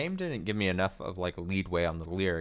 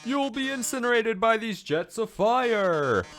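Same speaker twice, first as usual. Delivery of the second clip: teasing showman; 10 semitones higher, 6 dB louder than usual. Acted. Voices Mettaton's dialogue.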